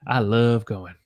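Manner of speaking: "love" held long; lingering vowel in "love"; Southern held love. Shown as a demonstration. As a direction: draw out "love" Southern style. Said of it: "You hold the".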